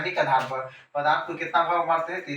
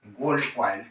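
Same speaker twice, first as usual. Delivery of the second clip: normal talking voice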